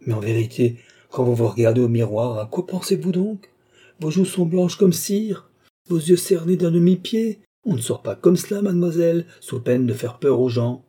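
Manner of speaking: high-pitched, exasperated father's tirade; worked-up parental reproach; indignant scolding. A narrator's reading. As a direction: berate her looks with rising pitch. Heated father scolds daughter's pallor.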